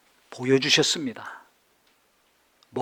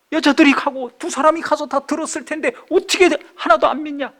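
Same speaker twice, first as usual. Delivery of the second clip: imagined scolding teacher; 7 semitones higher than usual. Retold or imitated. Preacher imagines teacher scolding disciples, exasperated and climbing. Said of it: "얼마나 야단을 치겠어요".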